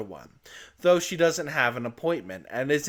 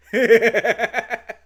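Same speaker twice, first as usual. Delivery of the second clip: high burst of laughter at own pun; bright laugh; 10 semitones higher, 10 dB louder than usual. Real, not acted.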